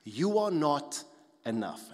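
slow, each word laid down; slow weighty verdict. Pretend. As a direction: press every word of "You are not enough". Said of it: "That's the voice".

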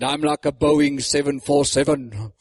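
put-on low voice with a German accent; Reinhard Bonke. An old pastor answering doubters, in a German accent. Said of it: "Spoke in that German voice".

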